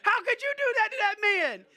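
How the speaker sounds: loud, high, laughing protest; laughing mock reproach. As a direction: tease the pastor while still laughing. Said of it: "We're like".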